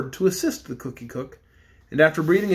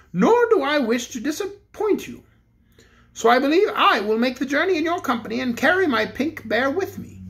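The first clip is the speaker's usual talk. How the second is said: higher-pitched kindly king voice; a toy king deciding graciously, pitched up; king agreeing to join the party, higher voice; Bear King; 7 semitones higher than usual. A narrator's reading.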